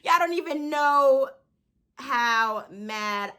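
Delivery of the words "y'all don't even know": usual speaking voice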